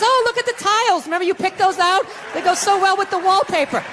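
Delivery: high, cooing sweet nothings, voiced; mock sweet talk. Husband distracting pinned wife with the tiles, mock sweet. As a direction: soothe her in a sugary high voice. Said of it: "you whisper sweet things".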